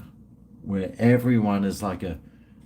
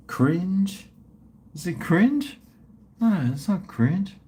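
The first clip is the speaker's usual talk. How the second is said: higher, puzzled questioning; 7 semitones higher than usual. Real, not acted. Streamer trying to recall the new dating app, puzzled. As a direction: grope for the name, unsure. Unsure what the app is called.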